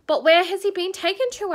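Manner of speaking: put-on a little girl asking anxiously; anxious Lucy. A narrator's reading. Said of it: "asked Lucy".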